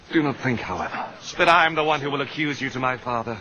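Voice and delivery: ordinary talk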